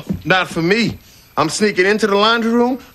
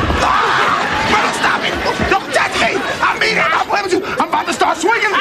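panicked yelling; 8 semitones higher, faster than usual. Acted. Fighting off Myrtle's advances.

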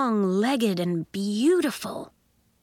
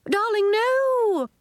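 very high, drawn-out protest; dismayed Miz Fitznickel; 4 dB louder, slower than usual. A narrator's reading. Miz Fitznickel stops Flamingo Nori at the pen, dismayed. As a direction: stretch it out high to stop the pupil.

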